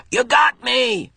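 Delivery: high, slow dramatic call; girl hamming being shot; hammed-up death cry. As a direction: play dying in a game. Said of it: "she called".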